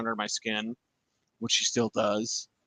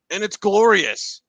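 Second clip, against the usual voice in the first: excited; 7 semitones higher, 6 dB louder than usual. Real, not acted. Delight at getting Sean Payton.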